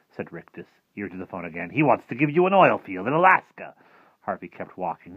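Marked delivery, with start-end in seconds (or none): 1.7-3.7 high, loud showman's announcement; upping the prize, big and swooping; excited offer. Acted. Rictus relaying the phone offer to Harvey, excited.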